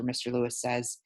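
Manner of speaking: usual speaking voice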